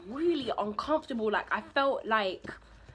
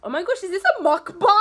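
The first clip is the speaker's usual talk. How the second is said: surprised; 10 semitones higher, 10 dB louder than usual. Real, not acted. Surprised at own eating vlog.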